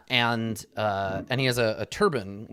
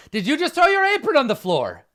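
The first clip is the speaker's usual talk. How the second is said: much higher, louder, faster indignant call; shout from the other room; 14 semitones higher, 6 dB louder, faster than usual. Acted. Partner in the next room scolding the smith, shouting. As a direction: yell it through the wall, scandalized. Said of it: "you hear from the other room".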